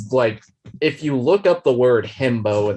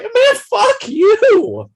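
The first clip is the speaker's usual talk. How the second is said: much higher, slow, louder groaned curse; drawn-out curse; 6 dB louder, much slower than usual. Retold or imitated. Viewer cursing Edward on screen, slow and higher. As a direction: stretch the curse in mock outrage.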